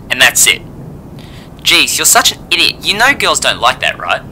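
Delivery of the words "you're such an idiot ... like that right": ordinary delivery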